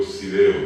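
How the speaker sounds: put-on child's "Sideo" with S in place of F. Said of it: "él dijo".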